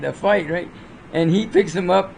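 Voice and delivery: ordinary delivery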